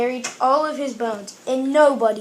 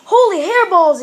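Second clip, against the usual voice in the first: loud, high-pitched amazement; exclaimed; 5 semitones higher, 7 dB louder than usual. A narrator's reading. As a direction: exclaim it in big surprise. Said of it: "exclaimed Skippy John Jones".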